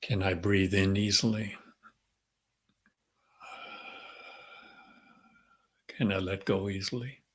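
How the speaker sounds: calm, unhurried meditation voice with long pauses; guided meditation. Real, not acted. Leader centering the group before the session, calm meditative prayer. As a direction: settle them gently into the breath, leaving space between phrases.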